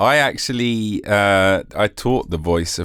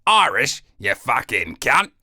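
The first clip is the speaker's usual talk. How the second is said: mock Russell Crowe; 4 semitones higher than usual. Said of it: "He's like".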